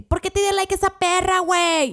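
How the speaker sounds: put-on high, loud jealous girlfriend shout; jealous yell. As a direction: yell at the boyfriend in a jealous fit. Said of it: "le gritan también a su novio".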